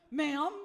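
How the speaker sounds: "ma'am" with a rising question lilt; a little question mark on "ma'am". Retold or imitated. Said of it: "There was a little question mark".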